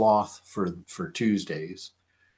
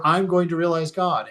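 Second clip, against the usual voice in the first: put-on mildly excited boy; 6 semitones higher, 6 dB louder than usual. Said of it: "he heard".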